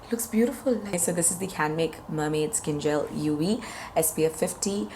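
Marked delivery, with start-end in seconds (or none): none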